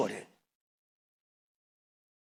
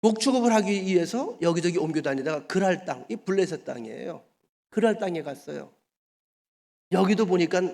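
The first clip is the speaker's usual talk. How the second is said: ordinary talk, nothing marked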